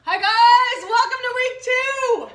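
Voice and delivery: very high, loud, drawn-out greeting; excited shout. Real, not acted. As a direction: holler a whooping welcome to viewers.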